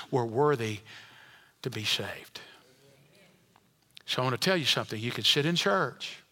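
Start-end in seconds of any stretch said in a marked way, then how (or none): 0.1-2.4 low, near whisper; hushed humility. Real, not acted. Preacher marvels at grace to congregation, hushed. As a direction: drop almost to a whisper in awe.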